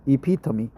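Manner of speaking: "epitome" as ih-PIT-uh-mee; "epitome" with the final e sounded as "mee"; correct pronunciation. Shown as a demonstration. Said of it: "Not epitome, but epitome".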